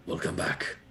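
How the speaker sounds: low, soft near-whisper; hushed reassurance. Acted. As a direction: murmur it gently through a door slat. Speaking softly through a cell slat.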